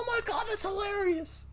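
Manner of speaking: very high mock squeal; imitated delighted reaction. Co-host imitating friend finally seeing it, high squeal. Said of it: "She was like".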